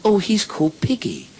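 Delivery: put-on high little-girl storytelling voice; put-on childish treble. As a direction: explain it as a small child, pitched high. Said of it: "She said".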